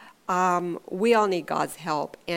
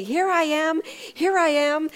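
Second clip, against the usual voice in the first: higher, louder, imitated eager child; bursting with excitement; 8 semitones higher, 5 dB louder than usual. Little boy itching to jump out, imitated excitement. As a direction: burst out like a giddy hider. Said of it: "he's just containing his excitement".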